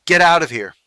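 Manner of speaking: loud barked dismissal; curt dismissal. A narrator's reading. Embarrassed officer chases Alex away, loud curt shout. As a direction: send him off with one loud bark. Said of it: "the officer's curt dismissal".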